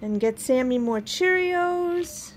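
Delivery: normal talking voice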